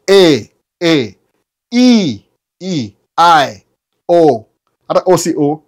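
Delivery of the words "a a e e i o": American accent vowels, A A E E I O drawn out in a put-on American accent. Shown as a demonstration. Showing viewers the American accent vowels, drawn out. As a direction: stretch each vowel like an American. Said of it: "U S ni nini?".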